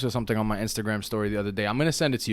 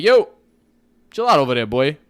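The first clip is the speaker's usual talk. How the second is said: louder mock call to chill out; hammed-up telling off across the room; mock scolding shout; 3 semitones higher, 8 dB louder than usual.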